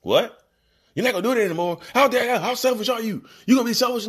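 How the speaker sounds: hammed-up indignation at being told no; outraged narcissist. The narcissist reacting to a boundary, high loud mock outrage. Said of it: "that's how it sounds too".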